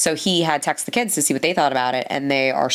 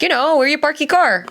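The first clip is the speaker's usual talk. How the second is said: exasperated explanation; 11 semitones higher, 5 dB louder than usual. Retold or imitated. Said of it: "he's like".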